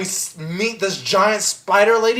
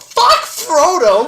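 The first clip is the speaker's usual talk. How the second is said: high, slow, drawn-out comic yell; exasperated outburst; 8 semitones higher, 4 dB louder, slower than usual. Real, not acted. Fan ranting against Frodo, exasperated. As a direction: burst out in laughing outrage, stretching each word.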